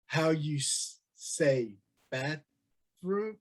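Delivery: slow, halting broken English, words stretched out; mock talking like a local in broken English. On mission trips asking locals for the bathroom, slow broken English. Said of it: "I'll start talking in broken English".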